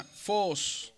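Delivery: "fos" lento y fuerte, con la O larga; vocal alargada. Shown as a demonstration. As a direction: estira mucho la O de "fos". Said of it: "en el circunflejo se alarga". ("fos" con la O sostenida que sube y baja)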